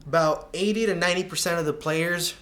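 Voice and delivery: ordinary talk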